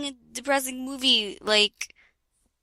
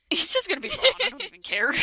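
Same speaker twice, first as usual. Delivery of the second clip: ordinary talk, nothing marked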